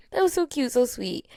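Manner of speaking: high, cooing gush with a laugh; mother recalling her delighted reaction, high and cooing. Retold or imitated. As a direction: coo over something adorable. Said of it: "I was like".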